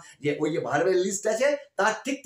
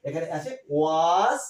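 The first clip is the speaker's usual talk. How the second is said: was বলা ধীরে, জোর দিয়ে; ধীরে টেনে was বলো; stressed was; 2 semitones lower, much slower than usual. Shown as a demonstration. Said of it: "auxiliary verb দেখবে". (ধীরে জোর দিয়ে was)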